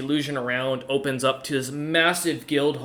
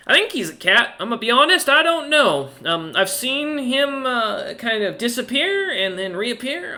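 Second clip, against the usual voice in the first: higher, rambling banker voice; chatty banker; 8 semitones higher than usual. Acted. Banker explaining her strange cat to James, higher and baffled. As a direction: gossip about the cat, baffled.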